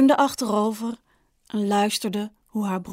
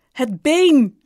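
louder, higher angry outburst; exasperated raised voice; angry cry; 4 semitones higher, 7 dB louder than usual. A narrator's reading. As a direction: snap it out, voice raised in anger. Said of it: "riep hij nu kwaad".

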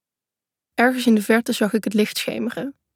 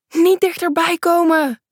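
calling out; 7 semitones higher than usual. Acted. Girl frozen between the trees warns the narrator, high frightened call. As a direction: warn him off from across the clearing. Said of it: "roept ze".

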